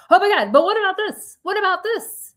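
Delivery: high, urgent mock fretting; acted panic. Mother retelling her bedtime racing thoughts, in acted panic.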